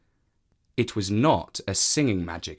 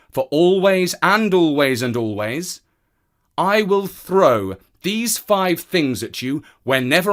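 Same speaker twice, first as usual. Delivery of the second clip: louder, higher threat; threatening man; 6 semitones higher, 5 dB louder than usual. Acted. Man threatening the cat, louder. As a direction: warn the cat hard.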